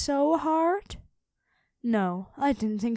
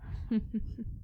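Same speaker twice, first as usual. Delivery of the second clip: breathy dark laugh; 7 dB quieter than usual. Acted. Dragon dropping her disguise before the hero, a quiet menacing laugh. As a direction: laugh softly as the mask drops.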